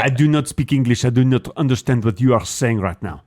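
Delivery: put-on stilted French-accented English. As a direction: play a French person struggling in English. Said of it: "a French person trying to speak English".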